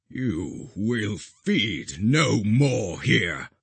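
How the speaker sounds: slow, firm final judgement; slow grave guardian. A narrator's reading. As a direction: state it slowly like a verdict.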